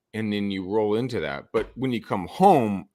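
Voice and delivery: usual speaking voice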